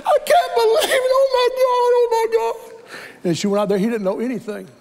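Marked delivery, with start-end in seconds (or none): 0.1-2.6 high, loud, sobbing shout of joy; crying scream. Retold or imitated. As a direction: scream it through tears, overwhelmed. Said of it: "She's crying and she's screaming".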